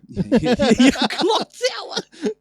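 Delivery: high laughing repeat, slow and loud; delighted friend laughing at the joke. Real, not acted. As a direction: laugh out the words clock tower.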